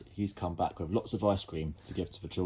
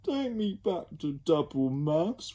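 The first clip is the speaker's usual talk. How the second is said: slow, high whimper; pitiful child whimpering; 9 semitones higher, slower than usual. Acted. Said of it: "whimpered a third".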